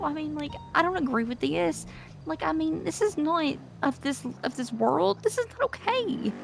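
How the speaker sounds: shaken, can't believe it; rattled disbelief. Real, not acted. Telling the group she saw a ghost, shaken and pitched high. Upset after seeing a ghost.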